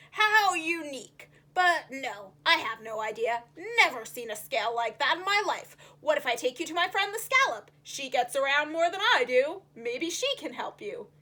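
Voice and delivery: grumpy puffer fish voice. Acted. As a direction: gruffly offer help.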